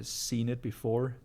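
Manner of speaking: ordinary delivery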